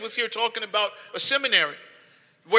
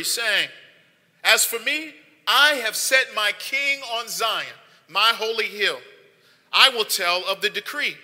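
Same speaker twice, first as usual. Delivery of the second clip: scripture reading; 3 semitones lower than usual. Acted. Pastor reading the psalm to the congregation, measured scripture reading. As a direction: read the psalm with weight and gravity.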